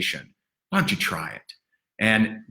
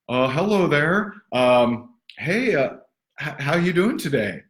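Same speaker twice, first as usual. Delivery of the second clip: higher, halting mock caller full of ums; awkward cold caller; 5 semitones higher than usual. Coach acting a clumsy cold call to a stranger, higher and halting. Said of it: "what would you say to them".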